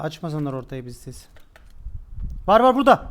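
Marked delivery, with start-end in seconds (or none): 2.5-3.1 higher, louder quick warning; urgent callout. Real, not acted. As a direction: alert the team sharply. Spotting an enemy.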